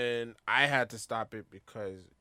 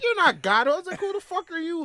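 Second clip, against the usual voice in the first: high, louder mock outrage; indignant retort; 8 dB louder than usual. Telling off the church girl who called him heathen, mock indignant. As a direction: act out an incredulous comeback, pitched way up.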